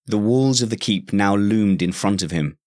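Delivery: ordinary delivery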